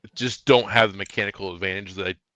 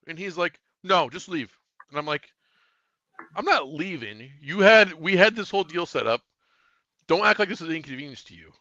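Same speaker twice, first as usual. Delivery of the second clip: getting mad; 6 semitones higher than usual. Retold or imitated. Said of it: "I'm getting, like, mad".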